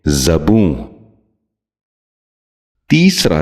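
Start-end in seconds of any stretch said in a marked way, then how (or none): 0.1-0.7 زبوں with short a first, "za-boon"; correct زبوں. Shown as a demonstration. Teacher modelling for viewers, زبوں said as "zaboon". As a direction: open زبوں with za, not zu. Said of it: "آپ بھی غور سے سنیے".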